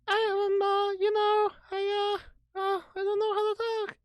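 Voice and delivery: very high, squeaky put-on voice; high boyhood voice. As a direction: squeak like before the voice dropped. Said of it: "I have a high voice".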